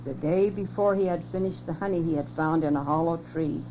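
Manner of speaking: ordinary delivery, nothing marked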